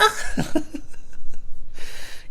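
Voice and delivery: real burst of high laughter; giggling fit; high breathy laugh. Real, not acted. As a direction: crack up at yourself. Laughs at own joke.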